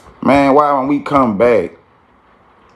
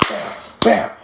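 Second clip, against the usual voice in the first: breathy mimicked smacks, high and soft; imitated slaps; whispered slap noises; 10 semitones higher, 5 dB quieter than usual. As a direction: mouth the hits quickly.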